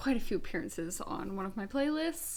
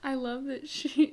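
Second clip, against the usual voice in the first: ordinary delivery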